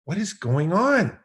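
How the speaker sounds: high, loud frantic self-scolding; yelling at himself. Retold or imitated. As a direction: shout at yourself in a panic. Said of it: "I started yelling at myself".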